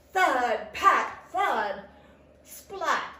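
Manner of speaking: loud, separate thuds and splat; impact noises. A narrator's reading. Snowballs waking Tortoise as he dozes, thudding. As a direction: punch each sound word hard.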